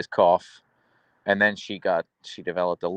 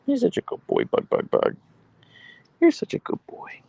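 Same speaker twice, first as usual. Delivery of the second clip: cooing pet praise. Real, not acted. Owner praises the dog as a good boy, high cooing. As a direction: coo high and thin, voice half gone.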